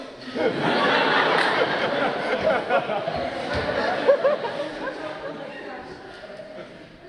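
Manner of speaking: loud cackling fit of laughter, breathless; a long, helpless burst of laughter; long loud laughing fit. Real, not acted. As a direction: laugh hard and long, out of control. Laughing at the nun joke.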